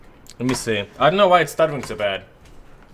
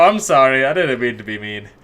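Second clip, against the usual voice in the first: ordinary talk, nothing marked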